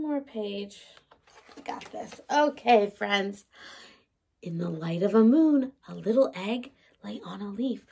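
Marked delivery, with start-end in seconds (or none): 1.6-2.2 hushed, breathy aside; a hushed little pep talk; whisper. Real, not acted. Teacher encouraging herself quietly. 5.9-7.9 soft, quick whisper; whispering the story to little ones; storytime whisper. Acted. Storytime hush for children.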